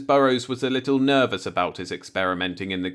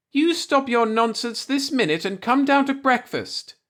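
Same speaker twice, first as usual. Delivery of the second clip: much higher, scolding wife's voice through a door; landlady hollering; 13 semitones higher than usual. Acted. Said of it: "hollered at him through the door".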